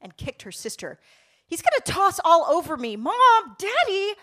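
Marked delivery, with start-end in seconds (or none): 1.5-4.2 loud, high, fast tantrum; exploding child. A narrator's reading. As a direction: shriek your complaint to Mom and Daddy. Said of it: "Rebecca exploded in the back seat".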